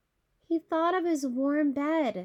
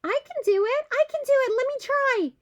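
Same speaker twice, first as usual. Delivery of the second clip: high, loud, bouncy eager voice; eager squirrel; 12 semitones higher, 5 dB louder, faster than usual. Acted. Squirrel bouncing to the front of the line, excited. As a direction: jump in begging for a turn, much higher.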